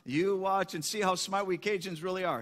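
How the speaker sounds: confident put-on Cajun accent; a joke-teller's try at Cajun speech. Boudreaux corrects the doubting farmer, Cajun accent. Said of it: "you silly Cajun".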